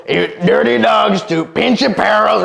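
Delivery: mock Trump bluster, drawn out; Trump impression. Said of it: "doing impersonations of Donald Trump".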